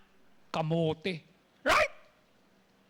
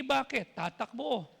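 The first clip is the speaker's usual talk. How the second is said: ordinary talk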